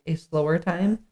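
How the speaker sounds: ordinary delivery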